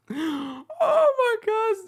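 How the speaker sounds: very high, stretched-out wail of laughter; drawn out, weak from laughing; helpless laughter. Real, not acted. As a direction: squeal it, real tears-of-laughter voice. Recovering from laughing fit.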